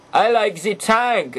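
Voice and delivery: put-on slow, drawn-out German accent; hammy German accent, stretching the words. Toy reviewer voices Blitzwing picking tank mode, German accent. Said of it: "He does speak in a German accent".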